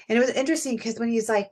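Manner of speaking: ordinary delivery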